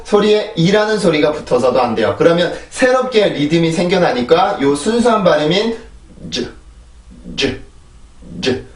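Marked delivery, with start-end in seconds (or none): 6.3-8.6 즈 as a short, soft buzz with no vowel; soft pure consonant. Shown as a demonstration. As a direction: breathe out a tiny 즈 sound. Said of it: "이 정도로만 발음을 해주세요". (아주 짧고 약한 자음만)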